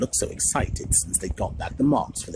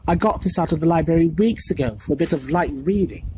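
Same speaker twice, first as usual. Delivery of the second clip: put-on higher, brisk clever girl; know-it-all girl; 6 semitones higher, 6 dB louder than usual. A narrator's reading. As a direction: say it as if obvious. Hermione matter-of-fact.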